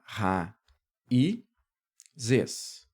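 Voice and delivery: "ra, i, zes" split by clear pauses; syllable by syllable. Shown as a demonstration. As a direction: say "ra, i, zes" as three separate syllables. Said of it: "Nós vamos separar".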